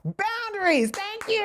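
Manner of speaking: distant voice from another room; called out from down the hall. Real, not acted. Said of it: "From the other room".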